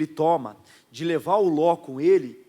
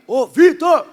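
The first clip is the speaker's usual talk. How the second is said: very high, very loud call of a name; yell; 10 semitones higher, 13 dB louder, much slower than usual. Retold or imitated. Friend yelling to Vitor across the bus, loud shout. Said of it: "Aí ele me grita".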